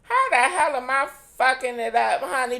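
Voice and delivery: high, loud mock indignation; mock outrage. Real, not acted. A cohost teased for fumbling Canada, squealing in mock offence. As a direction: shriek it out, playfully offended.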